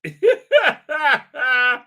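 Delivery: loud, high helpless laugh; real laughter. Real, not acted. Reviewer laughing at a slide worth keeping, loud real laugh. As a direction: burst out laughing uncontrollably.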